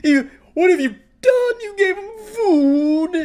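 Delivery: very high, drawn-out squeal; hammed-up wailing meltdown; mock screeching. Commentator imitating a man's meltdown over served food, mock screeching. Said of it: "screeching and squealing".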